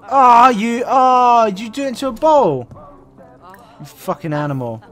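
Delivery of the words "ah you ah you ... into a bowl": loud, high groaning protest; real disgusted outcry. Real, not acted. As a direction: recoil loudly in disgust. Disgusted at Sim vomiting in bowl.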